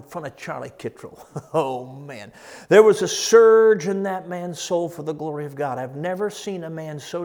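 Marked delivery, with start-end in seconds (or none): none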